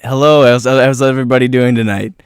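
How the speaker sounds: loud, stumbling comedian's greeting to a crowd; mock stand-up comic. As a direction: open an unprepared stand-up set, loudly. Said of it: "you'll be like this".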